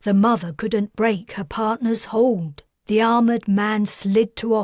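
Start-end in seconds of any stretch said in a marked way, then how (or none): none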